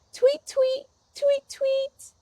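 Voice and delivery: high, soft chirping tweets; bird tweets. Retold or imitated. Teacher chirping like a bird for the kids. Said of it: "shimbiro says".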